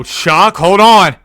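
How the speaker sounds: sudden loud, high outburst; excited shout. Real, not acted. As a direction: shout as if spotting a lucky find. Sudden lucky find in game.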